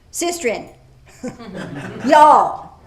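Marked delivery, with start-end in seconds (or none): 1.2-2.7 loud laugh through y'all. Real, not acted. Preacher joking brethren, sistren, laughing at y'all. Laughing at his own word play.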